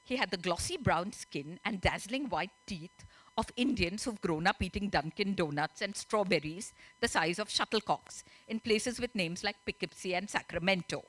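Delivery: plain reading voice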